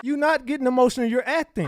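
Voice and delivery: loud, high mocking comeback; scornful retort. Real, not acted. Streamer talking back to [name]'s clip, loud scornful. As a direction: call her out loudly.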